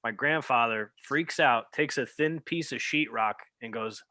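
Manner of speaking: ordinary talk, nothing marked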